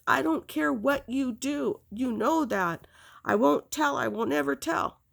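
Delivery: high, insistent re-enacted pleading; pleading vow. Retold desperate promise to Mom.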